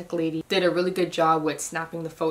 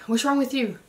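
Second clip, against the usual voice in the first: higher-pitched imitation of a puzzled mom; retold questioning mom; 7 semitones higher than usual. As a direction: quote mom's question with raised pitch. Mom questioning her in a retold exchange.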